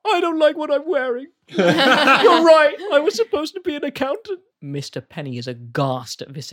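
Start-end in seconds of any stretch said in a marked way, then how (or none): 0.0-4.4 high, loud confession through laughter; tearful guard. Acted. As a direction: confess like a boy about to cry.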